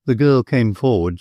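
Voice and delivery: ordinary delivery, nothing marked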